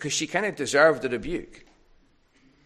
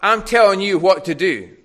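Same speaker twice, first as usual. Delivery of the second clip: louder, bossy demand, pushing each order hard; demanding complaint; 6 semitones higher, 10 dB louder than usual. Retold or imitated. Martha telling Jesus what to do, loud and demanding. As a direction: order him about like a put-upon host. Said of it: "for Martha to say to Jesus".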